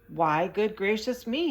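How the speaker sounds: a touch of startled distress; distressed cry. A narrator's reading. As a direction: gasp it out in mild alarm. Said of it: "he cried in distress".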